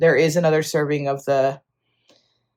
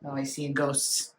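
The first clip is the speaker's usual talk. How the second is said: ordinary talk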